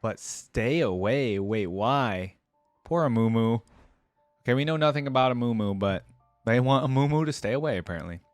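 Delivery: ordinary delivery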